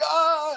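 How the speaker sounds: high, loud imitated holler; mock excited yell. Friend jumps on his back, loud high mock yell. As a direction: imitate a friend's playful pouncing shout.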